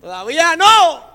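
shouted, slow and high; defiant shout. Retold or imitated. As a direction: yell it back at the devil, drawn out. El diablo quiere arrancarle la cabeza. (grito desafiante y lento)

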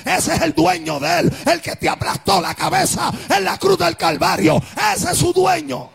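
high, insistent sermon climax; full-force preaching. Acted. Preacher declaring who owns the seal, full-force climactic preaching. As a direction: hammer each declaration, climbing to a shouted finish.

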